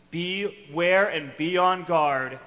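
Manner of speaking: each word "Beware and be on guard" drawn out slow and loud; slow emphatic warning. Acted. Preacher warning his flock against greed, slow and loud. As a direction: hammer home "Beware and be on guard" slowly. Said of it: "emphatic warning to church".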